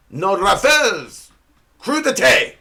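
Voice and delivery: put-on slow phrase, then high punch, in French accent; hammed-up French accent, drawn out; mock French accent. Comic cursing over party snacks, mock French accent. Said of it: "apologize in advance for my French accent".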